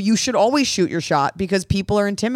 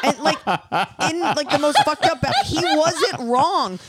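laughing; 7 semitones higher than usual. Real, not acted. Laughing through the story.

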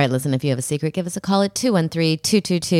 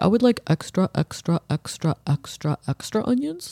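shameless customer order. Retold or imitated. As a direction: pile on the extras with no shame. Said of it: "be like".

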